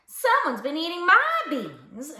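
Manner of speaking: mild-mannered mama bear. A narrator's reading. Mama bear finds her beans eaten, mild-mannered. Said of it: "in her mild-mannered, middle-sized voice".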